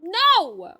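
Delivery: loud, high yell; defiant shout. Real, not acted. Streamer defying the game character, shouting. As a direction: shout it back at the threat.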